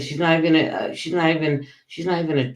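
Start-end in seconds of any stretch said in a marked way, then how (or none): none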